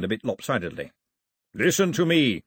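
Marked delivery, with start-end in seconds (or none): 1.5-2.4 high, firm call for attention; declaration. A narrator's reading. Milo addresses the raiders, raised declaring voice. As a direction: declare it to a crowd. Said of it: "he declared to the raiders".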